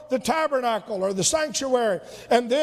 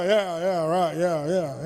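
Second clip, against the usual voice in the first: low, dismissive yeah-yeahs fading out; lightly voiced bored kid; mumbling child; 9 semitones lower than usual. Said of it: "and they go".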